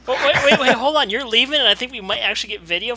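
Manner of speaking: loud, high, laughing protest; wait, wait, he's leaving, say it big; excited. Real, not acted. Host stops guest leaving.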